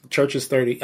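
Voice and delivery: ordinary talk, nothing marked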